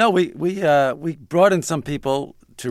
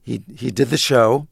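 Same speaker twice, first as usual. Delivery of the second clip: ordinary talk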